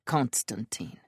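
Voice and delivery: low, soft and grim. Acted. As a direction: finish the name with dread. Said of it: "Gavriel finishes grimly".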